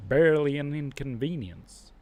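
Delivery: bad Canadian accent. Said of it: "that was a bad Canadian accent".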